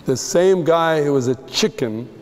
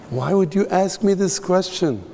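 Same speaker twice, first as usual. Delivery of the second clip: higher-pitched pained protest, acted; hurt questioning; 6 semitones higher than usual. Peter answers Jesus asking if he loves him, hurt. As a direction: imitate someone wounded at being doubted.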